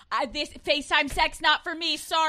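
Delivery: hammed-up turning it down; mock male refusal. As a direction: play a man bowing out, loudly. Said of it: "I don't see a man being like".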